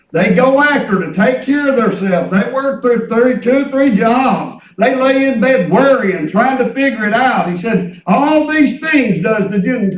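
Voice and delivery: loud, driving sermon delivery; fervent preaching. Real, not acted. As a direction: preach it out with punched phrase endings. Preaches with full voice.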